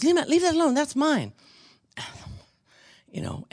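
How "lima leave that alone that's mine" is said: much higher, sharp possessive scolding; snappy lady's voice. Retold or imitated. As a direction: play the stranger guarding her toy, pitched way up. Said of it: "and the lady goes".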